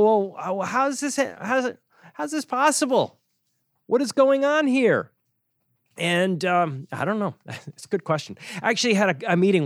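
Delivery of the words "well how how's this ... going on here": pitched up high, incredulous and puzzled; a bewildered investor wondering how this is even possible; baffled disbelief. Retold or imitated. Investor puzzling over overlapping funds, incredulous and high. Listener reacting to redundant fund holdings.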